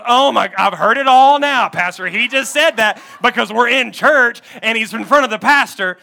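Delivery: loud, scoffing disbelief; indignant wife. Retold or imitated. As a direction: play an outraged wife calling his bluff, loudly. Wife scoffs at husband's promise.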